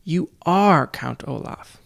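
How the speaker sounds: slow, every word weighed, stress on "are"; desperate accusation. A narrator's reading. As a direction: press hard on "are", slowly. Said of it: "Klaus wanted desperately to say".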